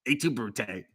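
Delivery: put-on Little Caesars accent. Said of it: "classic Little Caesars accent".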